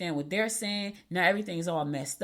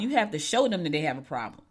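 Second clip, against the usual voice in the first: ordinary talk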